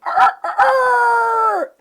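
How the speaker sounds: loud high rooster squawk; imitated rooster; rooster crow.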